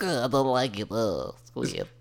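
nasal whiny nerd. Acted. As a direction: whine it like a nerd. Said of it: "It's my new nerd voice".

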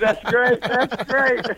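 high, laughing "that's great" dissolving into laughter; delighted chuckle through the words. Real, not acted. Guest laughing at the host's kid story, high and giggly.

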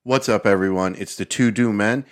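upbeat sponsor read. Acted. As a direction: pitch it like a sponsor spot. Hosts begin an ad read.

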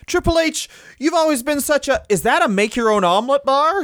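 high sycophantic squeal; mock fawning. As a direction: suck up to the boss. Outlaws fawning to Triple H.

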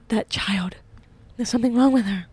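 hushed, horrified whisper; scared onlookers. Retold or imitated. Touring moms gossiping about the yelling girl, mock horrified whisper. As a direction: whisper it as if aghast, mock-scandalized.

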